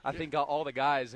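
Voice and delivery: winded, out of breath from the stairs; talking while still out of breath. Real, not acted. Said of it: "I'm winded from coming up these stairs right now".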